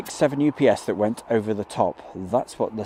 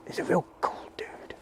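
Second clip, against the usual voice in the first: soft confiding whisper; whispered aside; 8 semitones higher, 9 dB quieter than usual. Real, not acted. Praising his New Jersey mate to viewers in a whisper. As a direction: let the viewers in on it quietly.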